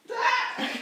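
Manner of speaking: a sudden high squeal with a laugh as you slide; excited squeal. Real, not acted. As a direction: let out a delighted shriek mid-slide.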